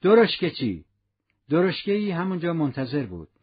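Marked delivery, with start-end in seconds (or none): 0.0-0.8 calling out loud for the cabman; a sharp loud call to the waiting cab driver; shout. Acted. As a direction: call the coachman across the quay. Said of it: "سپس فریاد زد".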